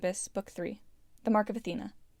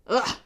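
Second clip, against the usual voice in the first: disgusted grunt; 3 semitones higher, 6 dB louder than usual. A narrator's reading. Villain disgusted by Percy.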